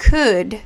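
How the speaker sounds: "could" with the L left silent. Shown as a demonstration. Teacher shows learners how to say "could", L silent. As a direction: say "could" as "cood", no L. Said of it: "esta letra no suena".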